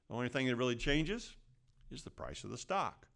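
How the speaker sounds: ordinary talk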